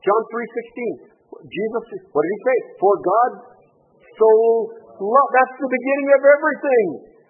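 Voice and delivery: high, loud, ringing preacher's cry; full-force preaching. Acted. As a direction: proclaim it over the whole room. Preaching on John 3:16.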